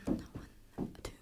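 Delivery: geflüstertes Einzählen für die Band; flüsternd einzählen, kaum hörbar; leises Einzählen. Real, not acted. Einzählen vor dem Song. (leise geflüstert einzählen)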